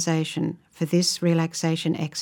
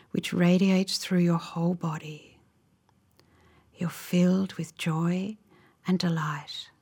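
unhurried, gentle phrases, leaving space to imagine; guided relaxation. Real, not acted. Guide leading listeners into a calming visualization, slow guided meditation.

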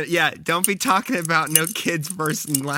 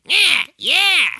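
much higher and louder parrot squawk; 8 dB louder than usual. Retold or imitated. Parrots answering him, loud high squawk. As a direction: screech it like a bird. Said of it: "they're just like".

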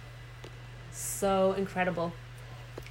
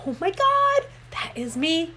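quoted gasp of recognition, much higher and louder; retold realization; 9 semitones higher, 4 dB louder, slower than usual. Hearing a speaker describe her personality, surprised. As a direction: relive the jolt of seeing yourself. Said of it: "and I was like".

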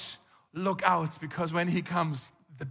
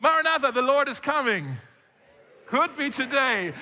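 higher and louder, eager proclaiming call; excited call; 6 semitones higher, 4 dB louder than usual. Shown as a demonstration. Pastor leads the congregation in the call, excited and loud. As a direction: lift it up like a joyful announcement. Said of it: "let's try it".

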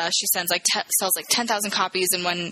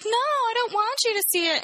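high-pitched protest. Retold or imitated. Woman guarding her phone's playlist, high-pitched protest. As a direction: voice leaps up in acted refusal. Acting out refusal to show playlist.

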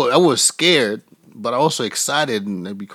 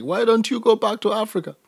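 mock yell; 10 semitones higher than usual. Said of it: "yell out to me".

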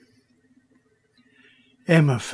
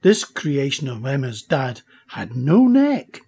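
plain reading voice

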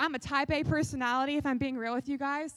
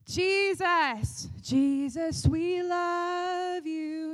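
slow, drawn-out worship croon; worship leader; much slower than usual. Pretend. Worship leader crooning to the congregation, slow and drawn out.

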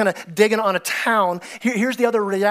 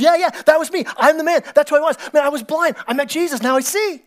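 much higher and louder, jubilant; excited testimony; 7 semitones higher, 5 dB louder than usual. Acted. Healed blind man joyfully telling the leaders, loud and high. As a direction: shout it out with joy.

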